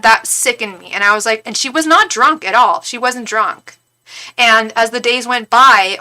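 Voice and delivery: normal talking voice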